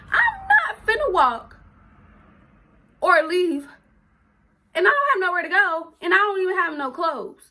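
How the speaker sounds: upset, emphatic retelling; distressed protest. Retold or imitated. Protesting being put out without clothes.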